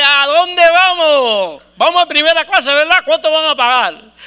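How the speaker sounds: loud, high, hammed-up delight; overjoyed partner. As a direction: burst in cheering, stretching the first question. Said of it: "llega lo más contento y me dice". (socio contentísimo, exagerado y a gritos)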